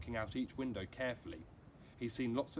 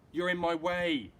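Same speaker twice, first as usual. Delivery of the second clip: put-on boy's voice, louder and higher, slowed; said loudly; 7 semitones higher, 11 dB louder than usual. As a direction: push the words out loud and annoyed. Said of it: "Bat said loudly".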